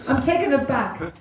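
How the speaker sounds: ordinary talk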